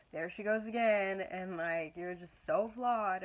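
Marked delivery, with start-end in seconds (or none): 0.1-1.2 mock-scornful sneer, louder. Imagined scornful God mocking her failings, louder. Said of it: "in this scornful". 2.0-3.2 slow, louder mock-scornful put-down. Imagined judging voice telling her she is flawed, slow. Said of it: "in this scornful".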